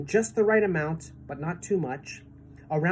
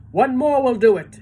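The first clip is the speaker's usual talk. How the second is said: very loud, high call; rally them for one more; shouted; 7 semitones higher, 9 dB louder than usual. A narrator's reading. Old green grasshopper calling out from the tunnel, loud. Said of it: "shouted the old green grasshopper".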